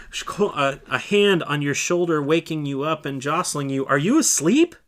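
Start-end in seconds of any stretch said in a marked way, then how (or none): 3.9-4.7 louder, higher, urgent question; urgent wake-up call. Acted. Leader waking the dozing disciple, urgent loud call. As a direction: rouse a sleeper sharply.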